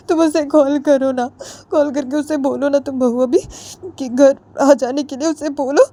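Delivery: a lightly voiced crying mother; tearful pleading mother. Crying mother begging her daughter-in-law to call Jiya, high and tearful. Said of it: "Ritu को रोते रोते बोलती है".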